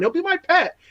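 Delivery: high cooing. Retold or imitated. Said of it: "I was like".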